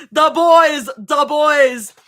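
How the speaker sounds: loud, high, excited whoop; excited cheer. Real, not acted. Streamer greeting the boys' entrance in the story, excited shout. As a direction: cheer loudly for their arrival.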